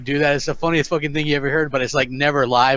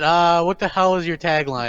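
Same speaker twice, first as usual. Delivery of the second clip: ordinary delivery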